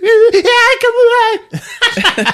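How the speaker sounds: very high mock-terrified squeal; squeaky, hammed-up scared whimper; scared crying girl. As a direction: imitate a frightened little girl crying. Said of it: "when the little girl cries".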